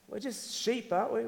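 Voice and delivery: ordinary delivery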